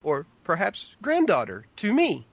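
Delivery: halting reading with a big swoop on "granddaughter"; dramatic pauses between "perhaps", "granddaughter" and "to me"; William Shatner. Acted. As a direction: break after "perhaps", then lift "granddaughter" and "me". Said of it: "You sound like William Shatner".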